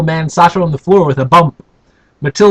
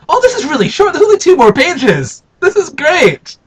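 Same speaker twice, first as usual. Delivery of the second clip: delighted; 9 semitones higher than usual. Real, not acted. Delighted by short book.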